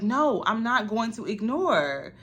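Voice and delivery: decide she's had enough; slow defiant decision. Retold or imitated. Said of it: "at one point I was like".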